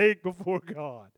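ordinary talk

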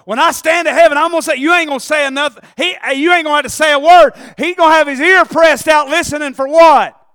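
loud, high, driving sermon; fired-up pulpit delivery; full-force preaching. Real, not acted. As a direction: preach it hard, punching each phrase.

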